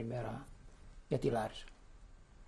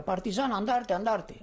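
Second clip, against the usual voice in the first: raised, higher, accusing cry of partisan; a hint of a soldier yelling accusations; mock accusing shout; 7 semitones higher, 4 dB louder than usual. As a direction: bark the accusation like a suspicious soldier. Germans accusing father of partisanship.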